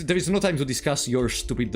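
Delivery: normal talking voice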